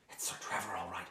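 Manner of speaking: very soft whisper to companions; whispered aside. Acted. Rescuer telling companions who is in the cellar, whispered. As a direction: confirm it under your breath.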